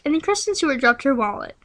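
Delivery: ordinary delivery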